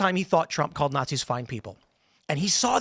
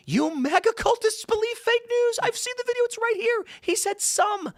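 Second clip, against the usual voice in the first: mocking liberal critic. Mocking a smug liberal critic to co-hosts, high put-on voice. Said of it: "they're gonna say".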